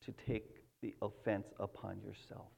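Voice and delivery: soft, low earnest prayer voice; hushed pastor's prayer to God. Real, not acted. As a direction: pray quietly before the sermon.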